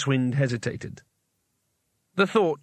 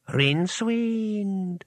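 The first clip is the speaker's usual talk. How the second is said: slow, drawn-out, higher ghostly call; a voice like old pages rustling, stretched out slowly; 7 semitones higher, much slower than usual. A narrator's reading. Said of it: "a voice like the rustle of old pages".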